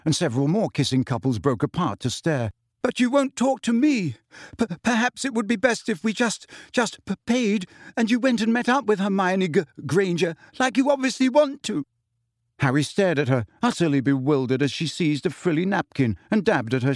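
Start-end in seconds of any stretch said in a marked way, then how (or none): 2.8-11.8 much higher, stammering p-, G-; hurt accusations through tears; high crying voice tripping over the words; tearful and jealous. A narrator's reading. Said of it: "her face now shining with tears".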